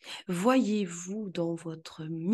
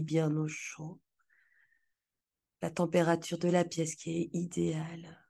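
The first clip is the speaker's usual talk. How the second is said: guided visualization. Acted.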